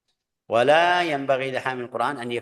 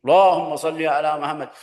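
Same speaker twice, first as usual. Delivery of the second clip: showy praise; 5 semitones higher, 9 dB louder than usual. Retold or imitated. Mocking showy loud praise.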